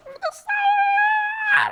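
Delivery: very high, drawn-out cry; overjoyed squeal. Retold or imitated. Host reacting to the old-time praise service, high drawn-out squeal. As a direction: stretch it into a high, overcome wail.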